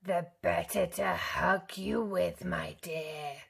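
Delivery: a hint of a growl; sly wolf; mild wolf growl. A narrator's reading. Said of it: "growled the wolf".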